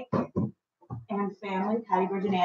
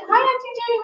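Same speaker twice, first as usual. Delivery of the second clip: bright, higher hello; cheery greeting; 10 semitones higher, faster than usual. Real, not acted. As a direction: greet her happily, voice lifted. Greeting aunt on camera.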